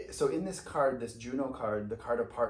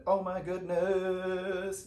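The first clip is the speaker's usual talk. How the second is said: drawn-out, higher gasp of wonder; amazed oh my goodness; 6 semitones higher, 4 dB louder, much slower than usual. Real, not acted. Reader delighting at the tarot cards, slow amazed. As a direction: savor the surprise slowly. Reader sees a new card.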